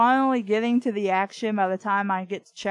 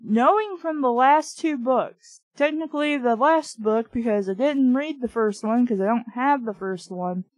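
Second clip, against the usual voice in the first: usual speaking voice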